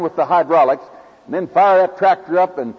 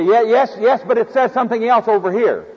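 excusing believer; 7 semitones higher than usual. Retold or imitated. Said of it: "and we say".

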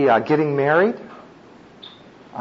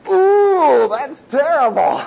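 high, loud mocking gasp of horror; mimicking critics appalled at the method; mock horrified listener; 11 semitones higher, 4 dB louder, slower than usual. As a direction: ham up the shocked critic. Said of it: "And they say".

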